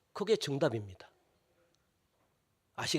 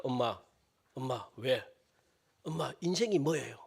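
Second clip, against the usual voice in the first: put-on soft, lower voice of a small child asking; a ten-year-old tugging at mom with a big question; 7 semitones lower, 11 dB quieter than usual. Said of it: "열 살짜리 자녀가 질문을 해요".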